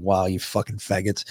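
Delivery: a soft mock mutter under the breath; low grumble aside; muttered insult. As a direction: say it quietly to yourself, half-hearted. Retold muttered insult after pat-down.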